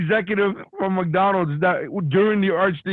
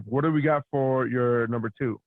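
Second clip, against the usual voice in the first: ordinary delivery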